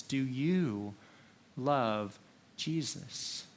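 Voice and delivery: YOU drawn out, then a slow "love Jesus"; stress on YOU. Shown as a demonstration. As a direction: make YOU personal, pause after it. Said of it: "question personally for each one".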